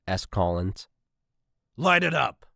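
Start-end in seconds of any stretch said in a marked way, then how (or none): 1.8-2.4 commanding. A narrator's reading. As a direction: bark the order. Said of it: "commanded Marks".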